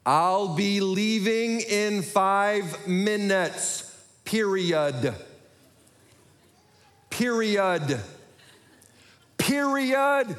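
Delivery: painfully slow, over-enunciated words; drawn-out high syllables, increasingly insistent; exaggeratedly clear dictation. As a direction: dictate as if to a dim machine, rising in frustration. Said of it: "wasn't being clear with what I said".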